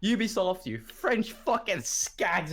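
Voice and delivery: high, drawn-out mock tirade; mock outraged rant. Gamer retelling Doc's outburst at Ubisoft, mock outraged. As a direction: hurl the insult in acted outrage.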